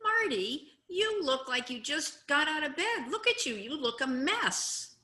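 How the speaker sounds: lightly acted teasing remark; mock put-down. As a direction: play someone picking on messy looks. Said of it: "supposing you said to me".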